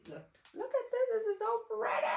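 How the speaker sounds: high, thrilled squeal; excited. Real, not acted. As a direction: gush over it excitedly. Excited showing 3D printed holders.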